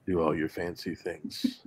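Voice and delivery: low seductive whisper; sexy velvet teddy bear voice. Acted. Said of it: "sexy velvet teddy bear voice".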